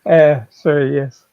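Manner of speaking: ordinary talk, nothing marked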